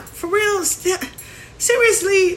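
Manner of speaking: high disbelieving pitch; incredulous. Real, not acted. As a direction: leap up in pitch, can't believe it. Exasperated at fearmongering.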